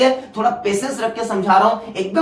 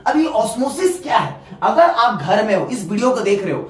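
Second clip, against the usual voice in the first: normal talking voice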